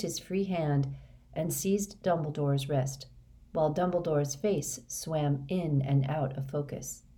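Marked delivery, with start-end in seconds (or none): none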